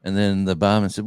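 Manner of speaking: ordinary delivery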